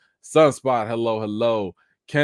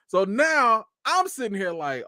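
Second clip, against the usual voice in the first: ordinary talk, nothing marked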